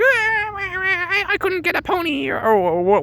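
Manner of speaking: high, whiny little brat; high spoiled-kid whine; whiny girl voice. Retold or imitated. Whiny child Kathy complaining.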